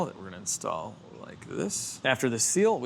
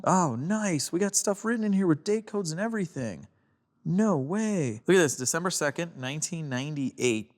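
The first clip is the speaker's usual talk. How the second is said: excited; 7 semitones higher than usual. Real, not acted. Finds date codes inside the turbo.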